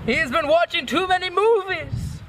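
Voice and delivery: very high, hammed-up cry; exaggerated high-pitched character voice; acted outburst.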